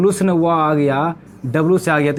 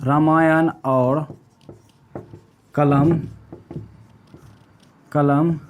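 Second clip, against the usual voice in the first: slow dictation; 3 semitones lower, slower than usual. Shown as a demonstration. Teacher slowly dictating the memory trick for notes. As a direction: dictate each word of the trick slowly, with long pauses. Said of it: "इसका हमने एक trick बनाया है".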